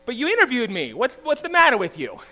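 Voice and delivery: high, incredulous retort; indignant protest. Retold or imitated. Retelling his baffled protest to rejection.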